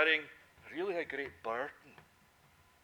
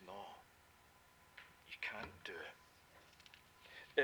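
whisper; 7 semitones lower, 8 dB quieter, much slower than usual. Acted. God's voice quoted, whispered.

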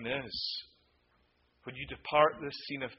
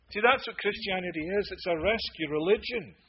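loud, high fervent pulpit voice; fiery preacher urging people to flee to Christ; preaching at full force; 6 semitones higher, 11 dB louder than usual. Acted. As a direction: build it up passionately.

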